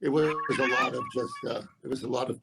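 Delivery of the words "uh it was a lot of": ordinary talk, nothing marked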